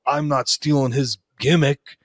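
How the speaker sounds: ordinary talk, nothing marked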